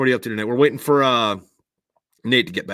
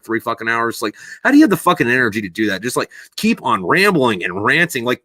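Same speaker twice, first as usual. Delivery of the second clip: ordinary delivery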